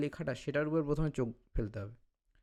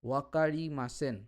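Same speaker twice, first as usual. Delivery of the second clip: slow careful reading; 2 semitones lower, slower than usual. Shown as a demonstration. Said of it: "আমি এটাকে বলার চেষ্টা করব".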